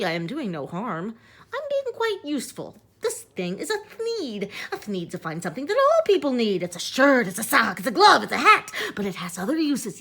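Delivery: fast, high, eager sales patter; salesman Onceler pitch. Acted. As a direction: sell the thneed like a huckster. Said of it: "Look, Lorax," I said".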